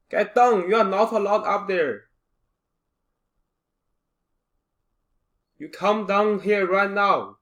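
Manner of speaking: loud shouted scolding; yelling across the room. Acted. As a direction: yell up at him to get down. Shouting at Kevin up high.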